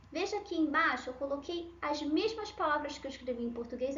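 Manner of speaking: ordinary talk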